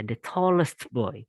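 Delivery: ordinary delivery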